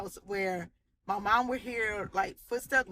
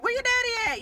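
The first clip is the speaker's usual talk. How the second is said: mom calling out; 13 semitones higher, 4 dB louder than usual. Retold or imitated. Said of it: "she's like".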